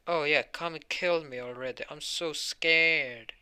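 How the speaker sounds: drip fake fear to provoke him; sarcastic taunt. Ost mocks Mars's threat with sarcastic fake fear. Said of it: "is just mocking him now".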